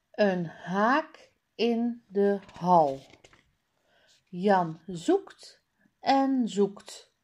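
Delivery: ordinary delivery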